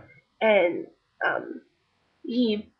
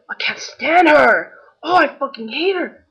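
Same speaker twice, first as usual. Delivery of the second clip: mock yell, higher and louder; angry rant; 6 semitones higher, 5 dB louder than usual. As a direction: retell an angry man ranting. Paparazzo yelling, punching car.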